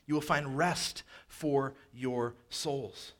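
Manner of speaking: very slow, rest leaned on hardest; slow emphasis. Acted. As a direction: let each word land, stressing rest. Repeat, much slower.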